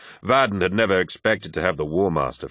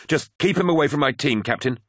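higher-pitched, tense warning; 7 semitones higher than usual. A narrator's reading. Apothecary to captain about his violent comrade, warning. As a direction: warn firmly, protective of the team. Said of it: "warned Vaden".

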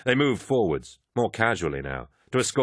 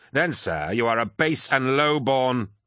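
slow, rising affronted anger; indignant insult; 6 semitones higher, slower than usual. A narrator's reading. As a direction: build to a higher-pitched insult. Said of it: "He glared across the table".

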